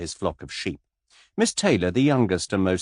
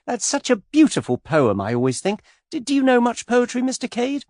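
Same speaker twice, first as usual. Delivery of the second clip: put-on much higher, girlish gushing voice; skittish young lady; 4 dB louder than usual. A narrator's reading. Miss Taylor pestering Mister Cade, high and gushing. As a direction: flutter up high like a giddy girl.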